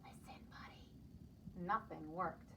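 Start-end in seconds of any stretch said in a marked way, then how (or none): 0.0-0.8 soft whispered call; whispering. Acted. Parents whispering to Buddy, soft whisper. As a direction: breathe his name in a whisper. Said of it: "They tried whispering".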